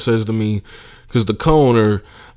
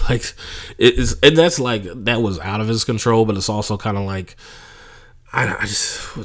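usual speaking voice

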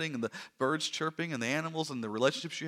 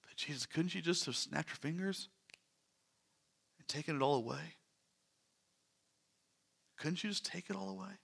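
much softer, a hushed plea; soft lament; 11 dB quieter than usual. Retold or imitated. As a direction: plead quietly, almost to yourself. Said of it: "And it makes us say".

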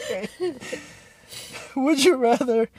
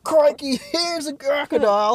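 hammed-up Australian "crikey", pitched up; Steve Irwin Australian accent; 7 semitones higher than usual. Joking as Steve Irwin spotting a crocodile, mock Australian accent. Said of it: "Call me Steve Irwin".

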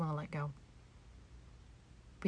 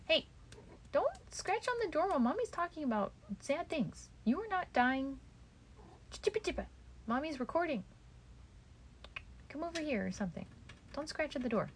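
higher pet voice, scolding the cat gently; talking to the cat; 8 semitones higher, 4 dB quieter than usual. Real, not acted. Mommy tells her cat to stop scratching the door, higher pet-talk voice. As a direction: talk up to the cat like a fussing owner.

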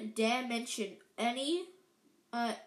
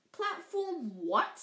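incredulous; 7 semitones higher, slower than usual. A narrator's reading. Aunt and uncle stare.

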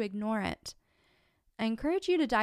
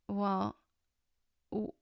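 normal talking voice

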